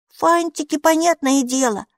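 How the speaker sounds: put-on high child voice; child's matter-of-course answer. A narrator's reading. Minni explains what the creature carries, high child voice.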